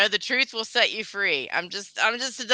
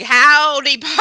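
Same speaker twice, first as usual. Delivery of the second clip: loud, higher Southern cowboy greeting; attempted Southern drawl; 6 semitones higher, 9 dB louder, slower than usual. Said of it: "Not even a Southern drawl".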